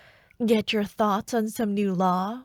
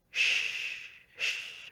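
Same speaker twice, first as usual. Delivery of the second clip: shushing whisper; 7 dB quieter than usual. Acted. Hushing the hidden one.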